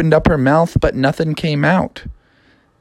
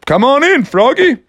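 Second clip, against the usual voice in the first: higher, loud welcome; principal voice; 11 semitones higher, 4 dB louder than usual. Acted. Principal welcoming Froggy, loud. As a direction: greet Froggy warmly at the door. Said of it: "principal said".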